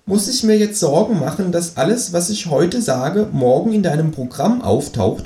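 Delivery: hoch gesetzte, freche Känguru-Stimme. Acted. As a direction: das Känguru schnoddrig und höher sprechen. Känguru fragt misstrauisch. (hohe, freche Känguru-Stimme)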